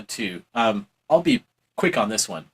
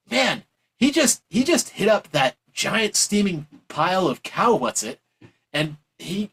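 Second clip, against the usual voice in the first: high, excited mocking paraphrase; mock Thatcher gushing; 5 semitones higher than usual. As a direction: gush like a dazzled fan. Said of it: "openly admitting that, like".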